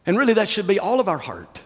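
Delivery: normal talking voice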